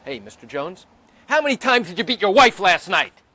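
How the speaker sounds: loud, accusing lawyer question; mock lawyer accusation. Defense lawyer grilling the witness, loud and accusing.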